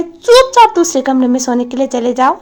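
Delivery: put-on loud stern command to a child; father ordering his son out, loud and stern. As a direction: bark the order at the kid. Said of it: "जितना high tone वो use कर रहा था".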